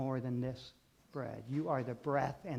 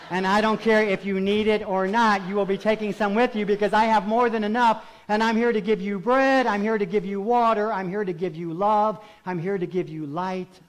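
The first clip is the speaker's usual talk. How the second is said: loud, raised, insistent pulpit voice; forceful preaching; 6 semitones higher, 12 dB louder than usual. Acted. Preacher promising bread to the congregation, forceful preaching. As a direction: drive each promise home to the congregation.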